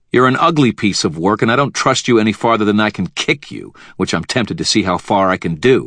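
loud, fast, angry retort; snapped back. A narrator's reading. Wizard to the villain, snapping angrily. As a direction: bite the words out at him. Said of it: "I snapped back".

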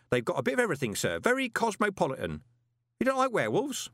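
high-pitched gossipy underling; lively, high character voice; chatty old postman Grote. Acted. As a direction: relay grim gossip with relish, pitched well up.

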